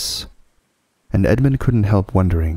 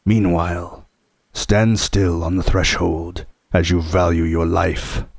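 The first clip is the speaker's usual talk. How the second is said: menacing wolf. A narrator's reading. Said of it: "said the wolf".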